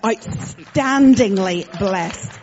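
ordinary talk, nothing marked

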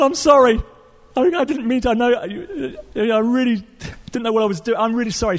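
high, stammering apology; panicked apology. Retold or imitated. Pastor thinks the father died, high panicked apology. Pastor pleads forgiveness from woman.

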